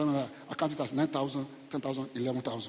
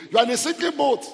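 loud, high-pitched pronouncement; booming warning; 10 semitones higher, 9 dB louder than usual. Retold or imitated. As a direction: proclaim it like an urgent warning from God. Voices God warning a man.

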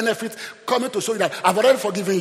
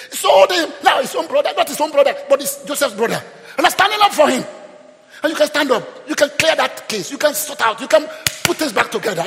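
high, loud, driving sermon; full-force preaching; 9 semitones higher, 9 dB louder than usual. Real, not acted. Preacher urging church to stand up for family, full-force preaching. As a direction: urge the congregation to stand up, voice raised.

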